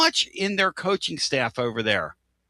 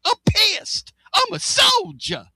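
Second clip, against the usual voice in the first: quoting a player's post-game rant, shouting; mock angry shout; 11 semitones higher than usual. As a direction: bellow it like a fired-up player. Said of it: "Who said that after the game".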